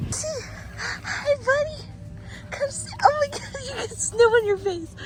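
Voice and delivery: very high, soft sing-song to the dog; dog talk. Real, not acted. Greeting the dog in the snow, high soft coo.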